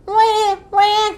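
high, imitated wail; baby seal cry. A narrator's reading. Baby seal heard from the beach, crying. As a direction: bleat like a crying seal pup. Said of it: "cried the baby seal".

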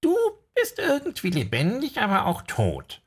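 high, odd voice of a strange figure; high character voice. Acted. Strange figure speaking to Leroy, high voice. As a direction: pitch it way up for the figure.

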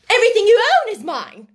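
fast, high, loud claim; angry outburst. A narrator's reading. Headmistress claims all the orphan's belongings, angrily. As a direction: burst out imperiously.

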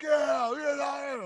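high, loud mock cheering; crowd cheer. Audience cheering the actor, high and loud.

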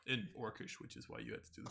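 ordinary delivery

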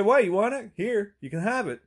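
high-pitched, goading comeback; mock defiant retort. Dog walker offering the bag to neighbor, defiant retort.

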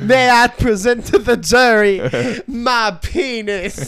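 loud, slow Southern courtroom drawl; Southern lawyer drawl. Pretend. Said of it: "like, a Southern defense lawyer".

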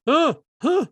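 high, loud short huhs; imitated dumbstruck huh. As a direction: mimic gawking teens with high grunts. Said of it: "some were just like".

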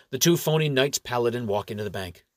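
ordinary delivery

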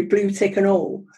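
ordinary delivery

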